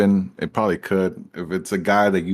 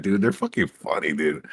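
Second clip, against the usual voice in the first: ordinary talk, nothing marked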